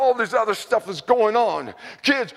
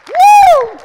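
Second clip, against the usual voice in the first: very high, very loud whoop of joy; ecstatic whoop; 15 dB louder than usual. Real, not acted. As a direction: let out a wild joyful woo. Preacher's outburst after hymn.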